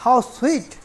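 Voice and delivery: how sweet said with delight; delighted exclamation. Shown as a demonstration. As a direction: coo it like you're charmed. Exclamation demo, second take softer.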